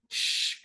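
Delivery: unvoiced, quiet shh; quiet shushing. Shown as a demonstration. Teacher hushes a noisy classroom, quiet shh. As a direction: shh a noisy room softly. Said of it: "class में हम कहते हैं".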